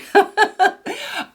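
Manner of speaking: short high giggle; high laugh. Real, not acted. Laughs at her own forgetfulness.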